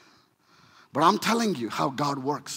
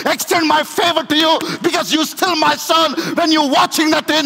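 loud, high, shouted sermon climax; roaring preacher over music; preaching at full force; 11 semitones higher, 8 dB louder than usual. Acted. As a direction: preach the father's welcome with everything you have. Preacher climbs to a shouted peak.

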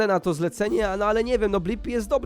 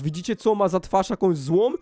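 normal talking voice

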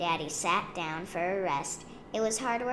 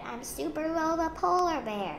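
high, proud little-hero announcement; child superhero exclaiming; excited cry; 11 semitones higher, 4 dB louder than usual. A narrator's reading. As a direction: declare it high and thrilled. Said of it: "she cried".

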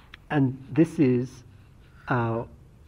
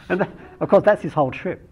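laughing; 7 semitones higher, 9 dB louder than usual. Real, not acted. Still laughing after quote.

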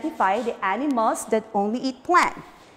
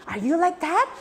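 normal talking voice

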